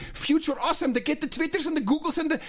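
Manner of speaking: put-on high, rattled-off European accent parody; mock European accent. Host imitates the speaker pitching packaging slogans, mock European accent. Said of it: "Did you hear how he's like".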